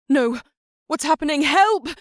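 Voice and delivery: high, loud panicked cries for help; anguished cry. Acted. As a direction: scream in terror. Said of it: "Duin's anguished cry".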